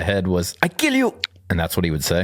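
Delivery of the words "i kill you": very high shouted puppet voice; Achmed voice. Pretend. Mocking Jeff Dunham's Achmed puppet, high squeak. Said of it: "that's Ahmed".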